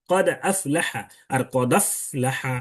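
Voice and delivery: slow কদ আফলাহা, with and without the hamza; contrast of two readings. Shown as a demonstration. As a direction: compare both কদ আফলাহা readings slowly. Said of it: "তাহলে কদ আফলাহা". (দুই রকম কদ আফলাহা ধীরে)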